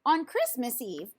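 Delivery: ordinary delivery, nothing marked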